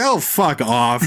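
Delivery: slow, drawn-out exasperated "fuck off"; exasperated groan. Retold or imitated. Host mocking the film's wink, slow and exasperated. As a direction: let the scorn drag out. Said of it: "And I was like".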